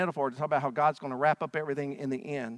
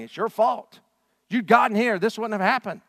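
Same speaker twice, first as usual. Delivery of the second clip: loud, higher angry blame; accusing crowd; 7 semitones higher, 7 dB louder than usual. Retold or imitated. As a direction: jab the blame at him. Crowd pointing, blaming Jesus.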